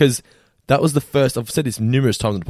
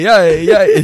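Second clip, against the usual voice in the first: high, loud laughing hey; 8 semitones higher, 9 dB louder than usual. Retold or imitated. Retelling a cartoon scene, loud laughing call. Acting character.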